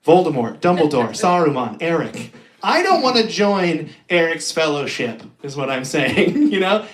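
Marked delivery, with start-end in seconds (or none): none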